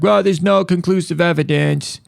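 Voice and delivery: high-pitched, slow mimicry of a doctor; mock dismissive doctor. Doctor brushing off a patient's diet question, mock dismissive. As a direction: drag it out high, mocking the expert.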